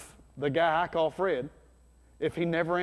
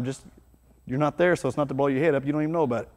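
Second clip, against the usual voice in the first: normal talking voice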